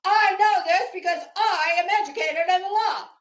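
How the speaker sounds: loud, high, pompous know-it-all voice, shouted; mock authority. Mocking Betty's fake expertise to the viewers, pompous shout. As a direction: ham up a smug lawyer who's sure she's right. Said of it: "she'd say it with a lot of authority".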